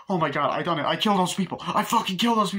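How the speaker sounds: high-pitched, building to loud panic; acted hysteria, rising; mock frantic confession. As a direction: imitate a man horrified at what he has done.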